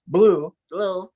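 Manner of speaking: blue with a B between B and D; lip-free blue; blue said without lips; ventriloquist blue. Shown as a demonstration. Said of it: "it came out".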